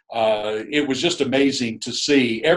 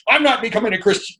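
much higher, quick hammed-up refusal; stubborn husband stamping his foot, high and quick; mock husband refusing; 11 semitones higher, 4 dB louder, faster than usual. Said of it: "every week ... that he would say".

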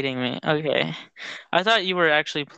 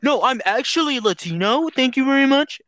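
mock prim correction; 8 semitones higher than usual. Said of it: "he's like".

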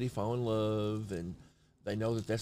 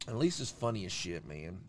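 ordinary talk

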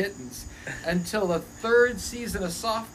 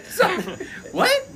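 very high, incredulous squeak; stunned disbelief; 12 semitones higher than usual. Retold or imitated. Storyteller learns the kittens were squeezed, stunned. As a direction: shoot it way up in shock.

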